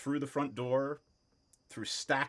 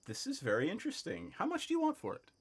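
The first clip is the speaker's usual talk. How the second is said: high-pitched shopkeeper, rising higher; intrigued shopkeeper; 10 semitones higher, faster than usual. Acted. Shopkeeper asks the price of the book, high and intrigued. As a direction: pitch it up into an interested offer. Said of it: "he says".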